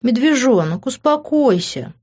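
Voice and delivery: lingering, teasing plea; drawn out. A narrator's reading. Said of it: "протянула я".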